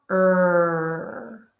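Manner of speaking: a held Er with the tongue pulled back; R sound demo. Shown as a demonstration. Teacher modelling the R sound for students. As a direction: sustain Er, lips rounded. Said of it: "Now let's make the R sound".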